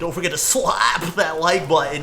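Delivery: hyped YouTuber outro plug; bright, pushy sign-off to viewers. Retold or imitated. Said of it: "that's my YouTuber voice".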